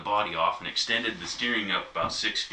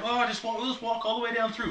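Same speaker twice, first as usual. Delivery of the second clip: much higher mock voice of the farm owner; quoting the farm guy. Farm owner invites them through the smelly huts, mock high voice. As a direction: chirp it like a carefree host. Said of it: "He's like".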